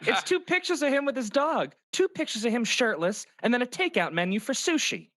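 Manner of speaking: high, put-on teasing character; high-pitched comic role; second character's voice. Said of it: "I'm both characters".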